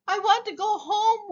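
high little wail. A narrator's reading. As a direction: wail like a tiny homesick creature. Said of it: "wailed the very small".

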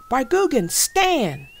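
high, loud battle call; big shouted launch cry; shouted command. A narrator's reading. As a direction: call the creature out to fight. Dan calls out battle command.

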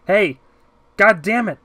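frustrated yell, high and loud; angry shout. Acted. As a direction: shout out as you curse. Trapped man shouts at railing.

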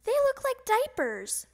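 put-on high childish whine; whining. A narrator's reading. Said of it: "whined Froggy".

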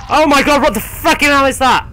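high, loud panicked scream at the screen; terrified shriek. Real, not acted. Gamer screaming at a monster, terrified. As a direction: shriek in real fright. Jump scare in horror game.

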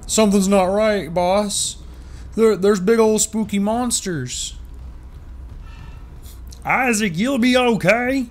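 high, loud put-on game NPC voice. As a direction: squeak it out like a nervous sidekick. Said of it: "the voice I give every N P C".

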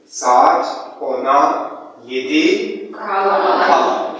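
slow, spelled-out teacher phrasing; slow classroom demo. Shown as a demonstration. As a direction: say it slowly for learners. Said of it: "Sadece varı değiştiriyor".

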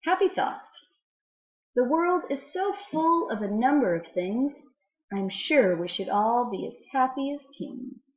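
mysterious, teasing read-aloud that slows at the end; riddle poem reading. Acted. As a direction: let the riddle hang for the children to guess. Reads Stevenson poems aloud.

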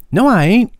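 put-on high, indignant boyish protest; young Tom caught in the act, protesting high. A narrator's reading. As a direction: squeak out a denial like a caught kid. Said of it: "protested the discomfited acrobat".

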